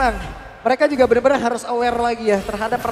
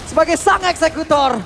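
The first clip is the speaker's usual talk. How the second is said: excited; 6 semitones higher, 5 dB louder than usual. Real, not acted. Co-caster recaps the teamfight.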